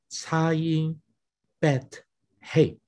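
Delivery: slow, spelled-out letters 擦音, bet, hey, each set apart; name the Hebrew letters 擦音 bet hey one by one, slowly. Shown as a demonstration. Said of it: "用这个希伯来文的这个三个字母来表达". (慢慢逐个念出希伯来字母 擦音、bet、hey)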